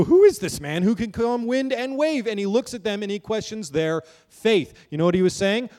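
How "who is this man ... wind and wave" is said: awed disbelief. Retold or imitated. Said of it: "And they go".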